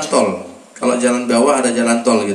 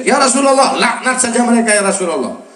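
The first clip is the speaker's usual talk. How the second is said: angry shout; 6 semitones higher than usual. Retold or imitated. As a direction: shout it in outrage. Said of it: "Marah Sayyidina Zaid".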